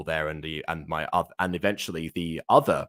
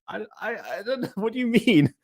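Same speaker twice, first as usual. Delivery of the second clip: flustered, confused. Retold or imitated. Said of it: "I was like".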